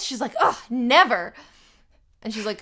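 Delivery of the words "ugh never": disgusted scoff. Retold or imitated. Acting the girl's disgusted answer.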